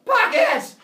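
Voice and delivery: sudden full-voice yell; yelled Pockets. Shown as a demonstration. Teacher demonstrating to class how she'll yell. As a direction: bark it out to startle the speaker. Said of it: "I will yell".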